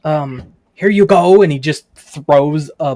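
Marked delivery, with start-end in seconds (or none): 0.0-1.4 shopkeeper voice. Acted. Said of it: "reaches underneath the table and goes".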